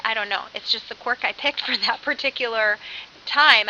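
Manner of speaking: ordinary delivery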